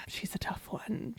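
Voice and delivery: sigh, then whispered resignation; weary whisper. Real, not acted. Owner sighing over reactive dog, weary whisper. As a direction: breathe it out under your breath.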